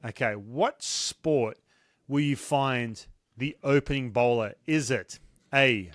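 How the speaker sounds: slow, deliberate quiz-master delivery; quiz host. Real, not acted. Host posing a multiple-choice question to a guest, slow and measured. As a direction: spell out each option slowly, with pauses.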